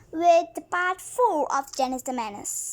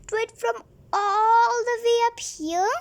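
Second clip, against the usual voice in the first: higher, pleading innocence; feigned innocence; 6 semitones higher, 3 dB louder than usual. Acted. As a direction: play the innocent kid, higher.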